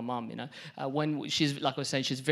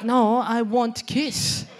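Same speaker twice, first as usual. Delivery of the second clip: higher, louder, drawn-out insistence; insistent demand; 9 semitones higher, 8 dB louder, much slower than usual. Retold or imitated. Mom arguing with grocery packer, slow insistent demand. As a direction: insist slowly, pitch raised.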